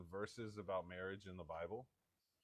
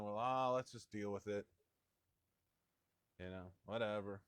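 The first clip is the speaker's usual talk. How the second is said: low, shrugging acceptance; mock resignation; 3 semitones lower than usual. Spouse the morning after a fight, mildly resigned. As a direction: play a lightly resigned spouse letting it go.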